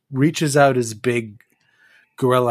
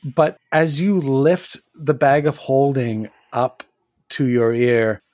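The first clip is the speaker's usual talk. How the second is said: usual speaking voice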